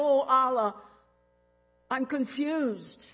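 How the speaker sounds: loud anguished plea, slowing on the end; a man on his knees calling out loud to Allah; crying out to Allah. Retold or imitated. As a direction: cry it out to God, lost and desperate. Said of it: "I cried out".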